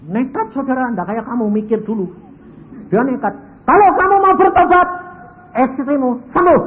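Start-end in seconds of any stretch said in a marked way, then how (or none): 3.7-6.6 much higher and louder, commanding; booming divine promise. Retold or imitated. God promises the husband his wife will heal, booming. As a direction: proclaim it as God.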